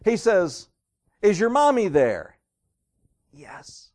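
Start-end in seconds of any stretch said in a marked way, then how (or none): 1.2-2.4 loud, high exasperated question; upset boss. Acted. Boss asks little kid for mommy, loud and upset. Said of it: "The boss was getting really upset". 3.3-3.9 put-on whispered child's answer; little kid's voice. Little kid answers the irritated boss, whispering. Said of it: "this little kid's voice".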